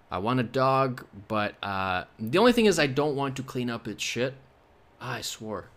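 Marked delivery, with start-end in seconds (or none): none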